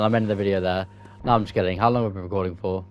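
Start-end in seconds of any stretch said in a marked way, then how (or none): none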